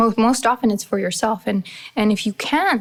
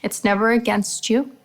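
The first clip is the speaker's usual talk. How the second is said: usual speaking voice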